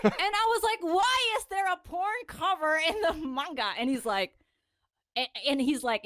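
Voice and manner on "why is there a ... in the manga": high-pitched mock-baffled question; retold indignant puzzlement, pitched up; baffled protest. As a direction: ask in exaggerated disbelief.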